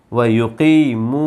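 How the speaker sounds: slow, measured chanted recitation with pauses; Quran recitation. Acted. Teacher recites the verses for students, slow and chanted. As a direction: recite it slowly, phrase by phrase.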